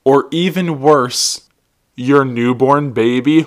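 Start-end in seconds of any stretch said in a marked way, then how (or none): none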